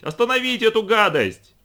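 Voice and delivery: high, loud shout. A narrator's reading. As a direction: yell at them to stop it. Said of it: "прокричала она".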